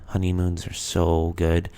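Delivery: ordinary delivery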